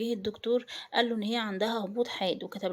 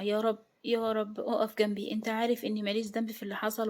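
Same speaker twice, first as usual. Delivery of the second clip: ordinary delivery, nothing marked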